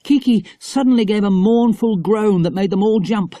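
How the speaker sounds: ordinary delivery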